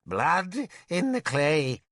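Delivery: put-on high, slow, rambling old wizard; babbling mad Merlin. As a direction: ramble like a madman sharing secrets.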